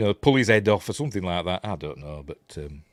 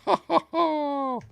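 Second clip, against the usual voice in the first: evil laugh; slower than usual. Retold or imitated. Ends the villain quote.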